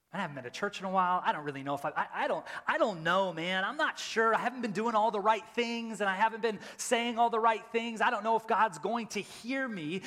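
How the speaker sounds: a lightly voiced anxious churchgoer; doubting believer. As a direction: sound unsure of God.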